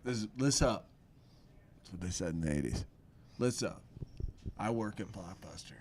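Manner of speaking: low, hushed, deliberate confession; low and soft, drawing out the last words; weary video store clerk in a scene. Acted. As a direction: play a clerk quietly confiding his troubles.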